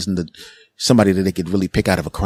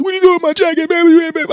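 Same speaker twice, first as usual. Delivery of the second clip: very high, squealed protest; outraged friend; 4 dB louder than usual. Acted. Friend whose jacket was just thrown in trash, outraged squeal.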